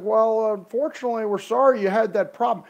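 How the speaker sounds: imitating Carlos. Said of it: "he kinda talks like this".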